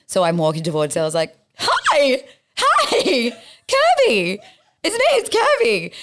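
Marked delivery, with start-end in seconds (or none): 1.6-5.9 very high, exuberant mock hollering; crazy girl yelling. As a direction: hail a stranger like an old friend. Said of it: "you're that crazy girl yelling".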